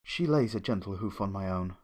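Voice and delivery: ordinary delivery, nothing marked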